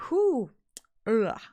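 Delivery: ordinary talk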